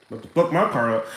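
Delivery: low, quiet, accusing mutter; suspicious lady's voice. Retold or imitated. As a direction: voice the woman eyeing him as a threat. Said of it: "she gonna look back like".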